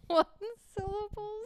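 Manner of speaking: high, helpless laughing question; cracking up mid-word. Real, not acted. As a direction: squeak it out through real laughter. Cracking up at a slip.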